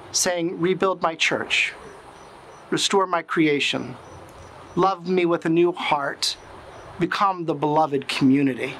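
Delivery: ordinary talk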